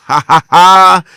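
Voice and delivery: very loud, drawn-out forced "ha ha ha"; mocking laugh. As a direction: laugh right in their face. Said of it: "so I just wanna laugh at your fa".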